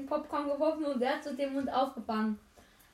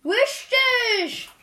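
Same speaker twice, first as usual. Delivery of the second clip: excited shout; 11 semitones higher, 9 dB louder than usual. Real, not acted. Loud exclaimed reply.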